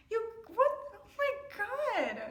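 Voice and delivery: high, slow, drawn-out dismay; aghast. Real, not acted. Reader taking in the dark twist, aghast. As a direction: let it sink in slowly.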